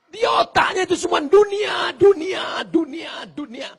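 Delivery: loud, high preaching cry, fading on each dunia; preacher's rising shout; fiery shout. Real, not acted. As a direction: hammer the word dunia, dying away. Preacher shouting about worldliness.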